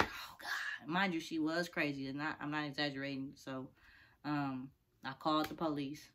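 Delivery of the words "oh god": whispered oh God; whispered dread. Retold or imitated. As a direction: breathe it out in fear. Dread, watching stalker.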